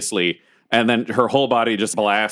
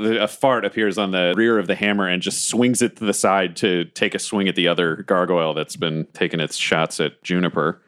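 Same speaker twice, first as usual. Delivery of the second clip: ordinary delivery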